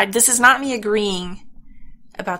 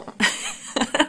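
puzzled laugh; 6 semitones higher than usual. Real, not acted. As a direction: laugh it off, baffled. Player confused by the character.

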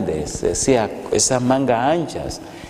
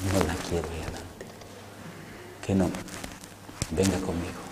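hushed command; 3 semitones lower, 13 dB quieter, faster than usual. Retold or imitated. Tells woman to come.

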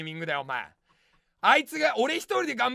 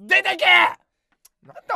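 shouting the viewer out, higher and louder; mock eviction; 6 semitones higher, 9 dB louder, much slower than usual. Comedian ordering a first-time viewer to leave, mock-angry shout. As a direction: yell get out in comic fury.

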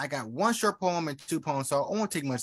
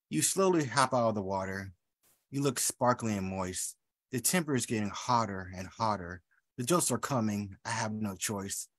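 lower, soft, seductive reading; seductive poem; 5 semitones lower, 4 dB quieter than usual. Acted. Poet reading an erotic poem to the room, low and seductive. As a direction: read it low and inviting.

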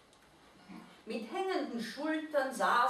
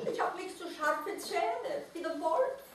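high creature voice, whispered start, then quicker; sad little mole-rat; 8 semitones higher than usual. A narrator's reading. Mole-rat rejects the owl's comfort, high voice.

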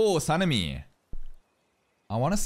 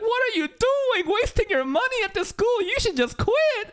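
mock roasting teacher; 4 dB louder than usual. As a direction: imitate a teacher tearing into a student. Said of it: "he would just roast him entire time".

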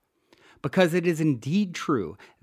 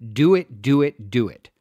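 louder, punching each do it; emphatic urging; 6 dB louder than usual. Acted. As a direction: push the listener to act, hammering it home. Urging the listener to act.

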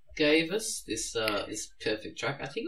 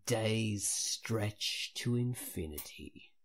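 dramatic trailer narration; 2 semitones lower, slower than usual. Acted. Reads a dramatic blurb.

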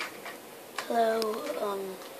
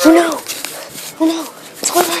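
high, loud pretend panic; mock panic; 9 semitones higher, 10 dB louder than usual. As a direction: cry out in play fear.

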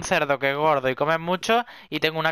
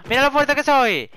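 excited shout; 7 semitones higher than usual. Real, not acted. Showing off strength, shouting.

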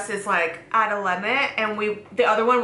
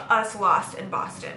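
ordinary talk

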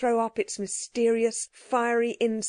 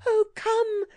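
put-on very high, urgent child's plea; pleading cry; 12 semitones higher than usual. A narrator's reading. Girl holds up the amulet and begs him, crying out. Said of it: "cried Jane".

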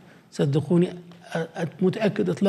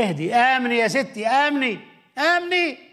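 mild reassuring; 6 semitones higher than usual. Acted. Lord calming Martha.

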